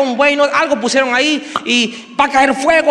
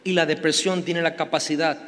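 soft intimate preaching; 5 semitones lower, 8 dB quieter than usual. Acted. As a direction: speak gently to each listener's heart. Soft reflective preaching section.

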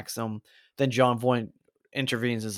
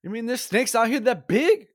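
much higher, mocking [name] movie quote; [name] line; 11 semitones higher, 3 dB louder than usual. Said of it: "It's [name] in Anaconda".

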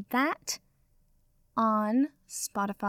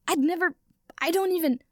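high and loud, tripping over words; Harry, shocked by the vampire's offer, sputtering high; flustered; 7 semitones higher, 5 dB louder than usual. Acted.

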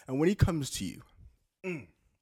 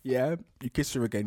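ordinary delivery

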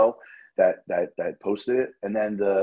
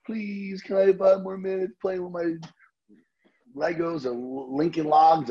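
put-on high pleading kid voice, much higher; a son begging his dad for more playtime, high and whiny; whiny little boy; 10 semitones higher, slower than usual. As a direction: beg like a small boy whining to his father. Kid begging father in story.

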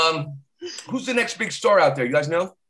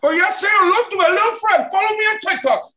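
much higher, louder laughing gangster yell; Tony Montana shouting; 14 semitones higher, 5 dB louder than usual. Retold or imitated. Tony Montana waving his gun, high laughing yell. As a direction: scream it like Scarface gone mad. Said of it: "Tony Montana".

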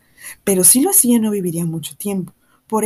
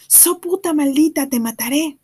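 higher, slow mock yell through a window; retold yell; 7 semitones higher, 3 dB louder, slower than usual. Tom retells Mr. Ewell yelling at Mayela: slow, higher mock yell. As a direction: shout the threat from far off, slowed down. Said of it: "se puso a gritar por la ventana".

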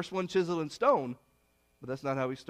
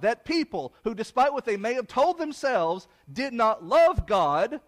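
slow, louder, pressing each word home; forceful preaching; 5 semitones higher, 5 dB louder, slower than usual. Acted. Preacher to congregation about the faithless generation, slow forceful preaching. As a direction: hammer the judgment home, word by word.

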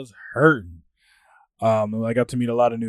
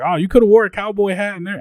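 higher, imitated Southern voice; southern accent; 8 semitones higher, 7 dB louder than usual. Groom tells guest about cowboy hats, Southern accent. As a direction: put on a friend's Southern accent, loudly. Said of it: "of course in his southern accent".

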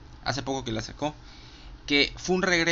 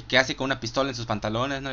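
normal talking voice

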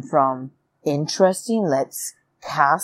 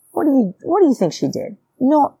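normal talking voice

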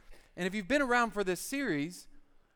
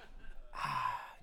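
heavy dread sigh; 6 semitones lower, 7 dB quieter than usual. Real, not acted. Bracing to sip LaCroix.

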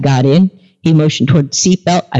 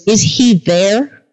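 drawn-out question; 4 semitones higher, much slower than usual. Retold or imitated. Driver's question, first take.

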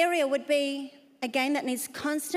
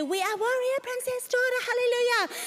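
mock tentative declaration; 10 semitones higher than usual. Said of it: "stand up there rather tentatively".

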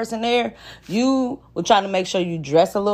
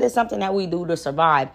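ordinary talk

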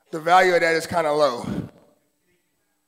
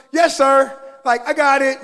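eager mock employee; 7 semitones higher, 7 dB louder, slower than usual. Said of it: "Y'all husband say".

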